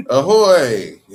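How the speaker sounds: loud, drawn-out ahoy that falls away; hearty hail. Shown as a demonstration. Host launching the ahoy phone greeting, hearty hail. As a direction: belt out a big ahoy like a hail.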